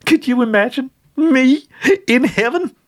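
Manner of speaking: put-on high, loud cackling old witch; high gleeful witch cackle-talk; laughing witch. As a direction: crow it through her laughter. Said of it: "as she laughed".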